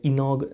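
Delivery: ઇનોગ ending in a hard g; mispronounced "inog". Shown as a demonstration. Teacher warns learners off this error, mispronounced with g. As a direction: say ઇનોગ as "ee-nog". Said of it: "નહીં બોલીએ".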